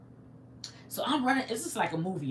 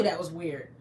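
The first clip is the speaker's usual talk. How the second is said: ordinary talk, nothing marked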